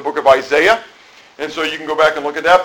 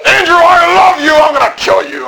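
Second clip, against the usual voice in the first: high, loud furious yell of love; mock angry shout; 7 dB louder than usual. Preacher mocking an angry declaration of love, shouting. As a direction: scream it like a threat. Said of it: "would you get that out of it".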